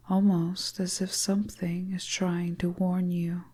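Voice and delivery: usual speaking voice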